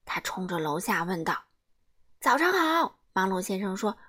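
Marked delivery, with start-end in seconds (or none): 2.2-3.0 higher, quick and cheery; a busy man bursting in at dawn; eager morning hello; brisk cheery greeting. Acted.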